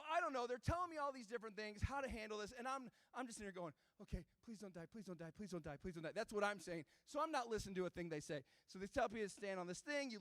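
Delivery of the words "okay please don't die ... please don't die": low, soft, rapid mantra; hushed, low self-talk of someone dreading the worst; muttered pleading. Retold or imitated. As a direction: plead under your breath on a loop. Silent pleading while getting strapped in.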